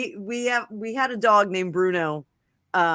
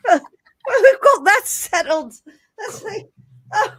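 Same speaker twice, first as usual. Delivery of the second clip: laughing; 11 semitones higher, 6 dB louder, slower than usual. Real, not acted. Breaking up after the skit.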